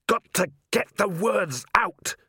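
high, slow, effortful shout; straining shout. A narrator's reading. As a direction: shout it while grappling. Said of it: "Carrot shouted".

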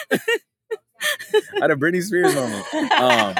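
laughing through the words. Real, not acted. Real laughter breaking into the line. Repeating the joke while laughing.

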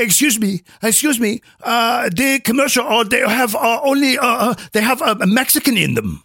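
hesitant complaint in a put-on Chinese accent; imitated Chinese accent. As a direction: play a Chinese client, haltingly, accent put on. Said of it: "they come back and they say".

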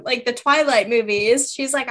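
ordinary talk

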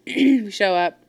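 ordinary delivery

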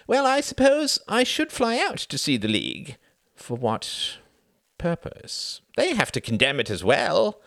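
worrying aloud; Sam, fretting politician. Acted. Sam weighing his options to Cuthbert, high and fretful.

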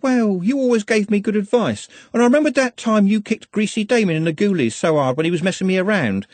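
put-on high, breathless girlish chatter; young girl Juliet. Juliet pours out her reasons to Glenda, high and eager. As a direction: run on like an earnest, eager girl.

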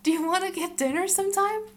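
high, loud gushing crush voice; asking someone out. Pretend. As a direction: ask them out all starry-eyed. Gushing about the writer.

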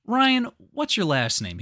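high, loud mock interrogation; interrogating TV host. Retelling a host confronting Ryan, loud and high. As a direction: confront him in a hammed-up host voice. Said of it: "he goes".

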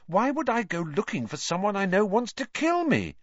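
put-on high, baffled boy; young lad at a loss; blank puzzlement. A narrator's reading. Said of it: "said Harry blankly".

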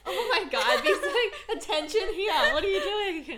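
high laughing disbelief; giggly, high mock outrage. Real, not acted. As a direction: laugh at the absurdity.

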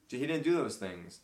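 normal talking voice

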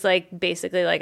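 ordinary delivery

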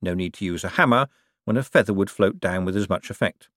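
usual speaking voice

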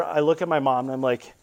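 normal talking voice

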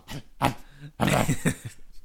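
drawn-out mock dog growl with a snap at the end; imitated growl. Copying the instructor's strange noise for his friend, mock growl. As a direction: growl like a dog biting at nothing. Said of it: "I just go".

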